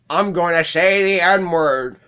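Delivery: high, slow drawn-out meme delivery. Acted. Kid in the meme announcing his plan, mock defiant. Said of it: "you know that meme?".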